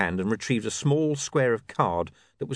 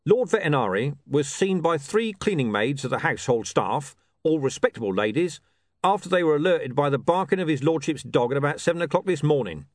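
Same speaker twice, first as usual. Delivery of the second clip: commander's police-report voice, pitched up; different, official tone; 6 semitones higher, 3 dB louder than usual. A narrator's reading. Watch commander reads out the facts of the case, officially. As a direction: recite it like an official statement. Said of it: "in a slightly different tone of voice".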